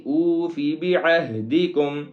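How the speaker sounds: ordinary delivery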